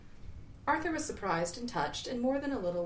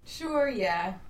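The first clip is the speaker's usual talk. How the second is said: casual higher Zaphod reply; a quick offhand Zaphod answer; 3 semitones higher, 6 dB louder than usual. Acted. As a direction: toss it off lightly as Zaphod. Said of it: "Zaphod replied".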